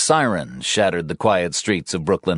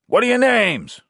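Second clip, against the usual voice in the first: high, loud calling; shouted exchange; 3 dB louder than usual. Acted. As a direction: call names through the rubble. Shouting through the door.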